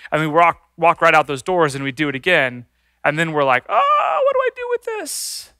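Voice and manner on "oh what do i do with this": high, soft, fretful wail; mock anguish. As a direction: fret in a thin high voice. Acting out troubled repentant sinner.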